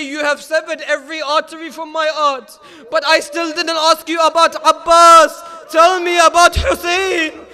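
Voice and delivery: a mother crying out that her heart is torn; grieving shout. Acted. Grieving mother shouts at the messenger for news of Hussein.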